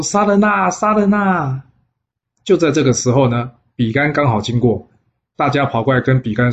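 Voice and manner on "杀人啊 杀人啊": high alarmed shout; crowd yelling murder. A narrator's reading. Onlookers shout after the spirit is struck dead, high alarmed yell. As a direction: cry out in panic at the killing. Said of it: "就大家喊说".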